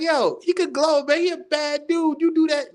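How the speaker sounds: mock kid voice. Boy defends his hero to his dad, high and pleading.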